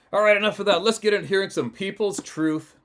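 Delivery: loud, high-pitched host announcement; big, booming-volume host kickoff; rousing segment launch. Real, not acted. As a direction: belt out the show transition.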